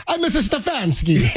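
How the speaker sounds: playful fake Italian, laughing; mock Italian accent. Italian fan impression.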